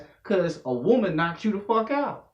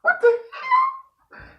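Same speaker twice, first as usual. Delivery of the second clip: very high, slowed squeal of disbelief with a laugh; laughing shock; 4 dB louder, slower than usual. Retold or imitated. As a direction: stretch it out in shrill laughing shock. Shocked reaction during sex.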